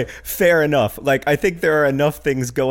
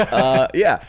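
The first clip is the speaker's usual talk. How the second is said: ordinary delivery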